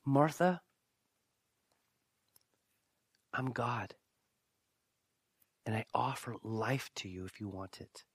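soft, low-key offer with long pauses; Jesus, gently declaring. Retold or imitated. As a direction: tell her quietly and tenderly. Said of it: "what he's saying is".

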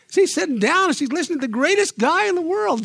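normal talking voice

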